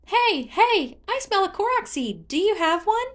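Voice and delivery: very high, loud eager spirit voice; sniffing out a treat with glee; excited Korok. Acted. Hestu sniffs out a seed.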